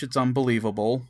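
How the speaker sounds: usual speaking voice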